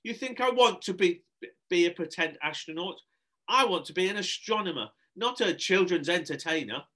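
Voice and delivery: high indignant rant; exasperated howl. Acted. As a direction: vent as a fed-up entertainer. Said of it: "howled, howled Sherry".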